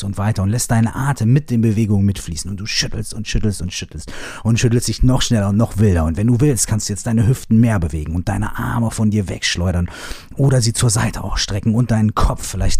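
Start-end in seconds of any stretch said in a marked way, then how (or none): none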